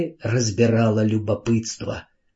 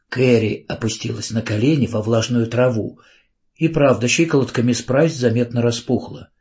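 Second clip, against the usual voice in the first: ordinary delivery, nothing marked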